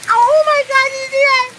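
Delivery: high, loud frightened cry at the lightning; real startled scream at a thunderclap; startled shriek. Real, not acted. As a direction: shriek it out, scared stiff.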